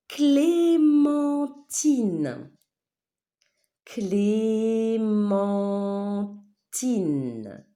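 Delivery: CLÉ-MEN-TINE, each syllable stretched long and loud. Shown as a demonstration. Teacher giving pupils a word to listen to, syllable by syllable. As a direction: draw out every syllable of "clémentine".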